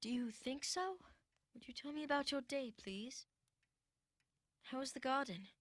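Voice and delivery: low, soft, gentle questions; subdued asking. Acted. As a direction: ask quietly, spent after a tantrum. Boy calmed after tantrum.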